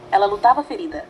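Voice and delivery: plain reading voice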